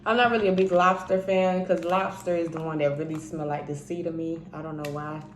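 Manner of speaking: ordinary talk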